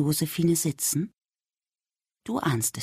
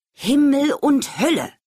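exasperated groan, loud and high; fed-up mother's outburst; groaning mum; 9 semitones higher, 5 dB louder than usual. Acted. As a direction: let mum groan it out in frustration. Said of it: "stöhnt Mama".

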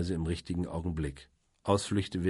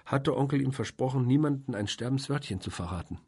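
put-on higher-pitched, eager young woman's voice; earnest Flora, animated; 7 semitones higher, 4 dB louder than usual. A narrator's reading. Flora reveals who the neighbour is, animated. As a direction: explain it eagerly, pitched well up.

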